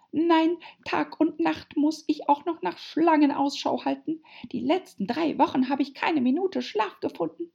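high, loud, complaining bird voice; aggrieved pigeon. A narrator's reading. Pigeon grumbling about hatching eggs, aggrieved.

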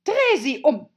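high, loud angry command; furious order. Acted. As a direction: order it in a royal fury. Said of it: "wandte sich wütend von ihm ab".

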